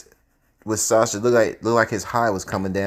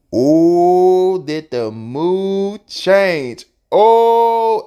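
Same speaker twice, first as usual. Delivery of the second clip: loud, high, drawn-out oh; milk the moment; dramatic exclaim; 8 semitones higher, 6 dB louder, much slower than usual. Real, not acted.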